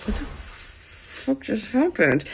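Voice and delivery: hushed, slow retold disbelief; stunned whisper. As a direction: whisper it under your breath in shock. Stunned mother looking around the restaurant.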